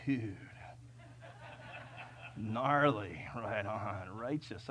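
low, drawn-out California surfer drawl; mock California dude. Californian showing how he should talk, mock surfer dude accent. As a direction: put on a laid-back California dude accent. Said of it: "I should be talking like that".

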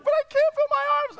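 put-on high, loud kid complaint; whiny child. Child complaining to teacher about aching arms, high whine. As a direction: whine like a tired little kid.

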